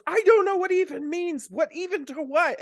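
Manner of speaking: high, baffled son's voice; teenage son confused about the word even. Retold or imitated. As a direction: sound lost and a bit whiny.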